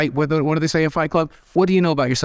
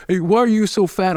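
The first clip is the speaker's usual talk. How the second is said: mocking bully; 7 semitones higher than usual. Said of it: "And I'd be like".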